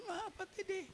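ordinary talk

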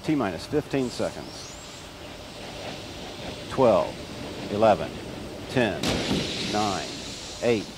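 countdown building to liftoff; mission control radio. Real, not acted. Launch countdown announcer over loudspeaker.

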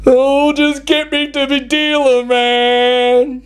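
dazed, sheepish druggie voice with laughs; sheepish stoner. Retold or imitated. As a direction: play the stoned passenger pleading. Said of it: "with this sheepish look on his face going".

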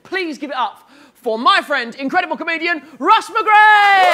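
rising to a full shout on the name; building announcer shout. Real, not acted. Host announcing comedian, building shout.

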